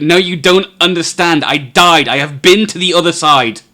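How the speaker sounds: loud, high, desperate insistence; frantic. Acted. Kevlan shakes Caporius by the shoulders, frantic and loud. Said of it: "shakes you like".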